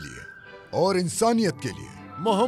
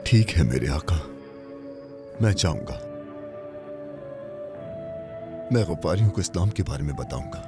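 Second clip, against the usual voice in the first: devoted and tender; 8 semitones lower than usual. Acted. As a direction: speak lovingly to a revered master. Speaks devotedly to the Prophet. (अपने आका से नर्म, गहरी और अकीदत भरी आवाज़ में वादा)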